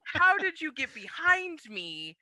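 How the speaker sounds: high, loud, laughing what-the; cracking up in total disbelief; laughing in shock at being outmatched. Retold or imitated. Stunned after being pushed out.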